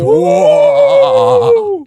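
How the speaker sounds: high shouted spooky whoa; spooky sound effect; vampire howl. Acted. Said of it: "we will turn into our vampire voices".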